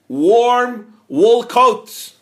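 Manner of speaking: drawn out slow and loud; savored. Acted. Rabbi's gift revealed, slowly stretched and stressed. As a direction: linger on warm wool coat.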